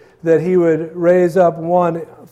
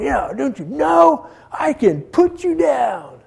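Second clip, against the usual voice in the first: higher, slow mocking taunt as Pilate in his face; 9 semitones higher, slower than usual. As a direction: sneer it up high and slow like a bully governor. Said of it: "Pilate's probably in his face, just going".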